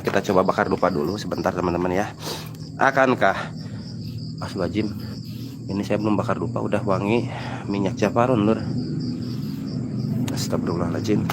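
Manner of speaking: quiet, uneasy murmur; hushed and spooked. Real, not acted. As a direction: talk low, like something's near. Smells perfume in haunted place.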